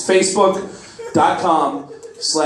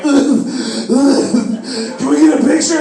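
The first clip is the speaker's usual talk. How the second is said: mock fan crying; 7 semitones higher than usual. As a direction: sob out the request like a starstruck fan. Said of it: "I started crying".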